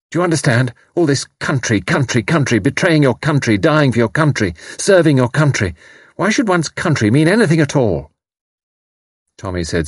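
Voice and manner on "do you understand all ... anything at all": high, insistent, scornful tirade; bitter outburst. Acted. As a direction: rant with rising indignation. Bitter outburst against patriotism.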